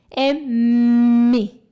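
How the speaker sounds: mi said loud, lips pressed hard together; M sound demo. Shown as a demonstration. Teacher showing students how the M sound is made, loud and drawn out. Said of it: "Como é que a gente faz esse som do M?".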